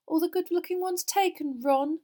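high, loud, superior taunt; lofty mock sympathy; loftily. A narrator's reading. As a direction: needle him from on high. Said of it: "said Hermione loftily".

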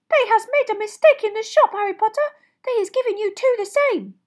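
agitated, very high elf squeak; Dobby. A narrator's reading. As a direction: squeal loudly about a great discovery. Dobby exclaims over matching socks.

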